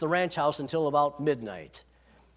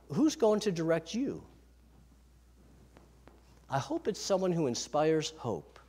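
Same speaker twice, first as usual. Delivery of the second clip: ordinary delivery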